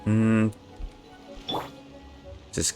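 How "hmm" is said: ordinary talk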